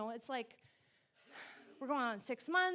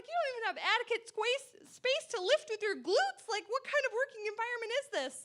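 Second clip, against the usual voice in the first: high, fast mock outrage; teasing hard time; 9 semitones higher, 5 dB louder, faster than usual. Wife teasing husband about his back injury, mock outrage. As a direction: rib him with exaggerated indignation. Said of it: "just giving him a hard time".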